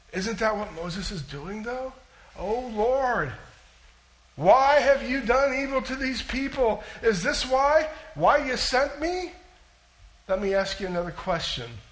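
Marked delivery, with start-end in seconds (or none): 2.4-9.3 anguished plea. Acted. Preacher requotes Moses' lament.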